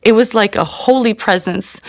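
ordinary talk, nothing marked

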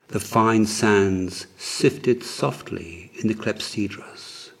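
usual speaking voice

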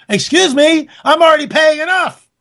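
high-pitched mock indignation; indignant retort. Acted retort to paying more.

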